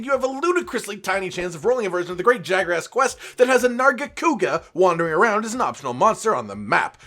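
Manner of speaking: ordinary talk, nothing marked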